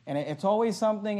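ordinary delivery

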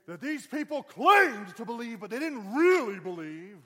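very high, slow, booming accusation; old-school preacher shout. Retold or imitated. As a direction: bellow it slowly like a hammed-up old-time preacher. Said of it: "I can be old school".

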